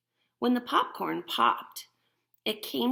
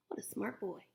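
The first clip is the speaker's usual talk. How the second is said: ordinary talk, nothing marked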